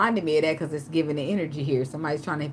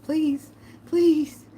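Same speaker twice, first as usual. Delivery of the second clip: higher begging pleases; mock begging; 10 semitones higher, 5 dB louder, much slower than usual. Imagined ex begging, higher mock pleading. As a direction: whine the pleases.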